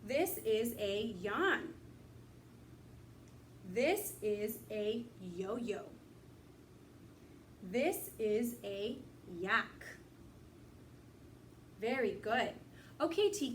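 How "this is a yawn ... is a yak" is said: slow, word by word; slow reading. Shown as a demonstration. Teacher modelling a short sentence for young readers, slowly. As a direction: read each word slowly and clearly. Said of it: "getting used to reading short sentences".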